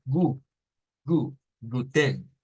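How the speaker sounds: "gu, gu, guten" built up slowly, no vowel in "ten"; slow German guten. Shown as a demonstration. Teacher slowly building up the German word guten. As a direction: break "guten" into "gu" then the whole word, slowly. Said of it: "Es como decirles".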